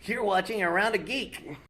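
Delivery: high, loud cartoon sidekick voice; bright greeting in the Krillin voice. Voice actor greets fans in his Krillin voice. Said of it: "Hi, it's Krillin".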